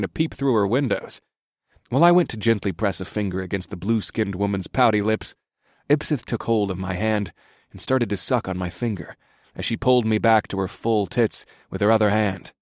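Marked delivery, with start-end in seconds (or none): none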